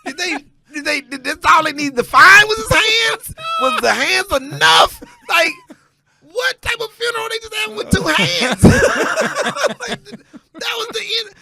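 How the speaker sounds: high, loud laughing outrage; cracking up while yelling the question; laughing disbelief. Retold or imitated. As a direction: shout it through laughter like you can't believe it. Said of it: "I'm like".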